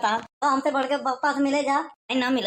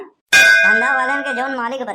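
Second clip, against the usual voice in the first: loud declaration; 9 dB louder than usual. Acted. Sudden loud line.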